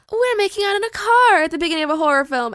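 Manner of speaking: high, quick, giddy imitation; mock teen excitement. Mimics carefree teens in horror film.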